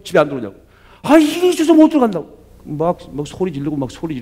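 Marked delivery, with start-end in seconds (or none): 1.0-2.4 much higher, louder exasperated shout; husband yelling back. Retold or imitated. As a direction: shout back that work keeps you. (회사 일로 못 간다고 아내에게 맞받아치는 남편, 짜증 섞인 고함)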